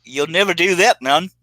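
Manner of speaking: put-on a light copy of the guest's UK accent; imitating Wayne. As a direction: echo the guest's line in a mild British accent. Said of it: "trying to study your voice 'cause you wanted me to imitate it".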